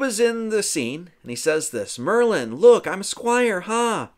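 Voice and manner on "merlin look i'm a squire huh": high, loud, slow showing off; acted boyish pride. Arthur showing Merlin his squire outfit, high acted pride. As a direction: show it off like a thrilled boy.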